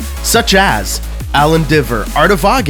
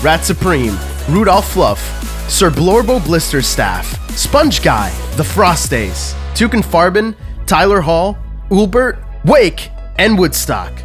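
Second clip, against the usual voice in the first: upbeat podcast outro; outro read; 2 semitones lower, slower than usual. Acted. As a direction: read the credits brightly. Patron credits outro read.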